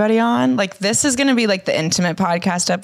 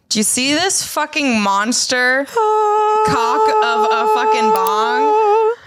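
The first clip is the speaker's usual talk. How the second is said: much higher, slow, stretched-out awe; amazed exclaim; 12 semitones higher, slower than usual. Real, not acted. Stoned host shows the bong to listeners, high and amazed.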